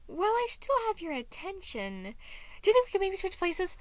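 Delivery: high, eager and rushing at the end; Patton's voice. Acted. Patton pleading with Anxiety to switch places, high and eager.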